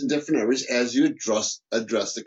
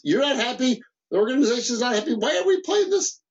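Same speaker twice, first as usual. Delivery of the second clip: exasperated confrontation; 9 semitones higher than usual. Acted. Boss challenging an unhappy employee, acted exasperation. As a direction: pitch climbing to an incredulous question.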